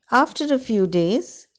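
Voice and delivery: ordinary delivery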